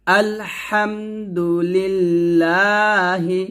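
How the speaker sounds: slow chanted tajweed reading of আলহামদুলিল্লাহি; teacher's model recitation; slow Quran recitation. Shown as a demonstration. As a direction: recite it slowly and melodically, every letter clear. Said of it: "এখন দুটো শব্দ একসাথে পড়ব".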